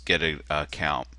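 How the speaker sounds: ordinary talk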